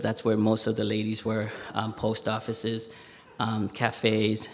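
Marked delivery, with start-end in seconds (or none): none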